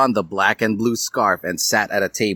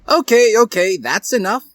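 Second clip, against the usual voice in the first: stern teacher; 13 semitones higher, 5 dB louder than usual. Acted. Teacher breaking up the kiss.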